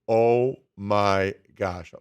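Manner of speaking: low, drawn-out astonishment; slow down as if stunned by the clip; retold amazement. Host retelling first sight of Wemby clips, slow amazement.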